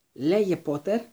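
high, forceful order; narrated angry yell, held in check; howled demand. A narrator's reading. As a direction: demand an answer fiercely. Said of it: "ούρλιαξε ο Σνέιπ".

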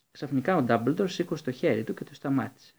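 usual speaking voice

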